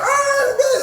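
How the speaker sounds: very high whine, imitating the roommate; hammed-up, high-pitched moan; mock near-crying wail. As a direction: wail it as if about to cry. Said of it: "You about to cry".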